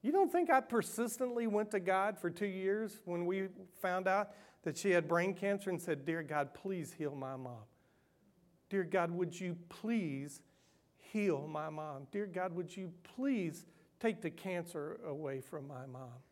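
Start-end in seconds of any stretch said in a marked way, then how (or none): 6.1-16.2 quiet pleading prayer. Retold or imitated.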